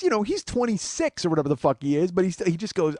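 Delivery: usual speaking voice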